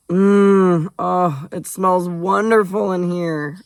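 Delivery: long, high, loud appreciative mmm, then slow relish; delighted savouring. Acted. Ari savouring the kitchen smells on arrival, delighted.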